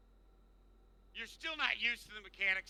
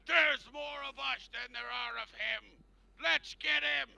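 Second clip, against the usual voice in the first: raised, louder rallying cry; mob ringleader; 8 semitones higher, 6 dB louder than usual. Acted. Mob leader rallying villagers against the party. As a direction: rouse the mob to attack.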